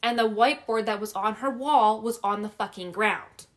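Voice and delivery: usual speaking voice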